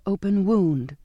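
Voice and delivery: ordinary delivery, nothing marked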